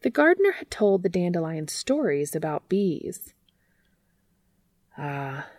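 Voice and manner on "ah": a soft, sorrowful sigh, much softer; sad sigh. A narrator's reading. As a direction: let the "Ah" sink down sadly. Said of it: "said the dandelion sadly".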